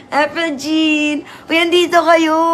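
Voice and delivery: high, loud, delighted call; drawn-out name, then a loud happy welcome; excited greeting. Real, not acted. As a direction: call out to friends who just turned up. Greeting viewers who just arrived.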